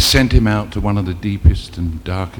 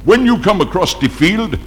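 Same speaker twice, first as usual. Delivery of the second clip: higher, knowing punchline voice; the chief springs the joke; chief's voice; 8 semitones higher than usual. Acted.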